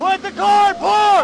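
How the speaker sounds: shouted surrender demands. Real, not acted. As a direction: yell each word hard. Officer ordering driver at gunpoint.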